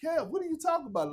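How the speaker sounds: high, quick mock-innocent doctor; doctor feigning innocence in a high, fast voice; playing dumb. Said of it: "He got to play the fool".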